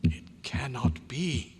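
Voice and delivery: slow hushed disbelief; awed whisper. Acted. Doctor stunned at the flesh, whispering in disbelief. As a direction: breathe it out in shock.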